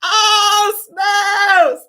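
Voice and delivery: high exclamation amid real laughter; laughing hard. Real, not acted. Host whose cheeks hurt from laughing, laughing exclamation. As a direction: cry out, helpless with laughter. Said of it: "My cheek's starting to hurt".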